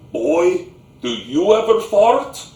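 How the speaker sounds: put-on booming Slavic-accented grandpa, rising to a high question. Grandpa questions the boy kneeling below him, booming Slavic accent. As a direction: boom it out in a thick Slavic accent. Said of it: "in that thick Slavic accent, that booming voice".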